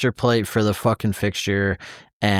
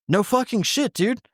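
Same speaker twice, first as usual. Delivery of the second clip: much higher-pitched, incredulous retort; exasperated comeback; 10 semitones higher than usual. Retold or imitated. Retelling a comeback to the guy questioning the shimming, exasperated. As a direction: throw it back, pitch shooting right up.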